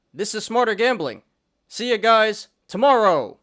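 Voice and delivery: high, loud sign-off catchphrase; hype outro. Acted. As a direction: shout the show's sign-off.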